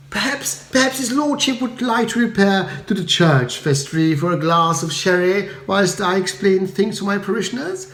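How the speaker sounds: smooth things over politely; soothing vicar. A narrator's reading. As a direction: vicar gently calming the crowd. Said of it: "decided to intervene".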